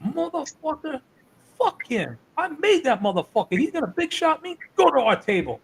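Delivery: high-pitched, indignant wrestler impression; Dusty. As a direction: imitate him cursing in a high, put-on voice. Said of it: "And Dusty turns around and goes".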